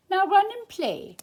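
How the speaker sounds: mother sheep voice. A narrator's reading. Mother sheep sends Lambert off.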